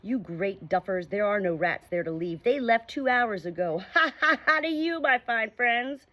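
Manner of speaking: mocking dog calling across the water; dog taunting the sinking pirates; dog jeering. A narrator's reading. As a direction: jeer at the pirates and laugh. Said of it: "Jip shouted across".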